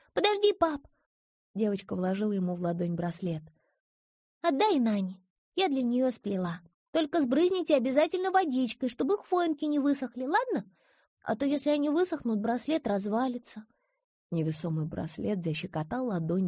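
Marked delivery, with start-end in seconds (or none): 0.1-0.8 put-on high little girl calling out; child voice. A narrator's reading. Girl calls father. 4.4-13.7 put-on high earnest little girl voice; child voice. A narrator's reading. Girl gives bracelet.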